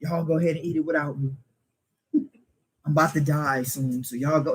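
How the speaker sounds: low, soft, weakly acted invalid; feeble sick excuse. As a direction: beg off quietly as if poorly. Acting out a too-sick excuse.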